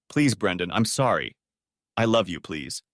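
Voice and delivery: plain reading voice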